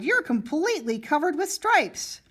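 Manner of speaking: cried out. A narrator's reading. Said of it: "she cried".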